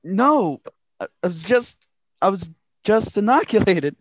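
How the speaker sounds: put-on the boy fumbling an answer to his boss; boy detective. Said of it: "Angus McDonald, boy detective".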